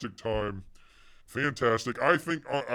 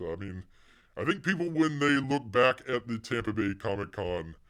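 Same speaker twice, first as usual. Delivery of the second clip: ordinary talk, nothing marked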